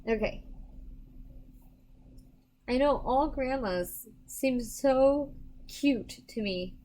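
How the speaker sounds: ordinary talk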